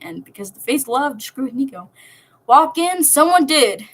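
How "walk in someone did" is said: louder and higher, playing up the twist; dramatic reveal. A narrator's reading. Narrator revealing the intruder, loud and higher. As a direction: announce it like a punchline.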